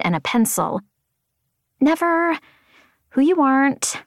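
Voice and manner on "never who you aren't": eager note-taker. A narrator's reading. Sadie writing down her mentor's saying, eager note-taker.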